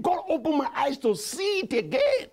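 ordinary delivery

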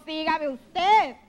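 coaxing slow call; slow drawn-out plea. Acted. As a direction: stretch each word slowly. Host drawling follow request.

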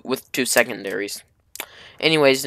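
usual speaking voice

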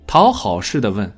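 ordinary delivery

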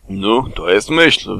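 put-on slow drunken slur; slurred drunk. Eeyore drunk after the wine, agreeing in a slow slur. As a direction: mumble it slowly, tipsy. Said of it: "wybełkotał Kłapouchy".

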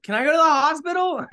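bleeding and begging to leave; pleading question. Retold or imitated. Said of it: "I'm like".

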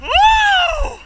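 loud high whoop of joy; victory whoop. Real, not acted. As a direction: whoop it out at the finish. Crossing the finish line, cheering.

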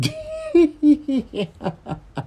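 long high-pitched cackle; squealing burst of laughter. Pretend. Character cracks up.

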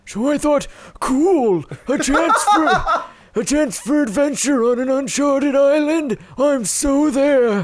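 put-on high, slow old man voice; creaky old adventurer reading his diary; old traveler voice. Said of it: "when we adopted that voice".

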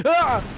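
sharp high yelp of surprise; startled scream. Real, not acted. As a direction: yelp out loud, caught off guard. Sudden scare in game.

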